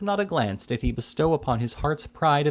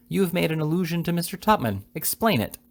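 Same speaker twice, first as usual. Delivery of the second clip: higher-pitched, insistent demand; emphatic lady, voice pitched up; 8 semitones higher than usual. A narrator's reading. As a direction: press firmly for an answer. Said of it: "said the spinster aunt emphatically".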